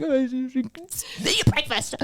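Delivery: slow, dismissive mum; brushing it off. Retold or imitated. Caught crying, mum brushes off her daughter, slow and dismissive. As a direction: wave the question away, slowly. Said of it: "I was like".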